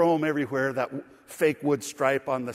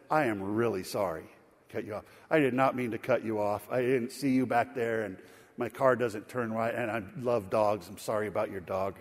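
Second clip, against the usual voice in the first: lower, subdued, sincere apology; calm apology; 4 semitones lower than usual. Retold or imitated. Pastor apologizes to yelling driver, calm and sincere. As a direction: defuse him gently, in a low voice.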